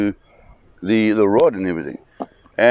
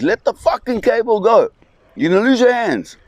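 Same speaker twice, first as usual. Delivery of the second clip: urgent shout; 12 semitones higher than usual. Retold or imitated. Father yelling at Arthur to drop the cable before the sub hits, frantic high shout. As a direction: bark it out so he saves his hands. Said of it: "And my dad says".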